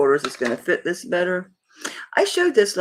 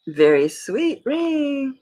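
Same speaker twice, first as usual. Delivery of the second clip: high, slow gushing "so sweet"; 10 semitones higher, much slower than usual. Real, not acted. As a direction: swoon over it, high and drawn out. Admiring the ring.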